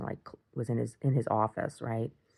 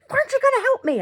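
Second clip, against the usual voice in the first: very high, loud mock whine of frustration; frustrated imitation; 7 dB louder, much faster than usual. Frustrated partner demanding help with cleanup, mock exasperated. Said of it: "he got all frustrated".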